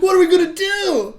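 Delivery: high, very loud hammed-up panic; screaming Hudson. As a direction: wail it like a soldier falling apart. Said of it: "Hudson is just screaming constantly".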